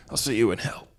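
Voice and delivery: whispered last gasp; dying whisper. Acted. Dying man whispering to Terry. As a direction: breathe the words out with no voice.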